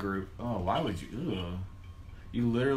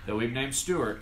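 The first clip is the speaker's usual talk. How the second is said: ordinary talk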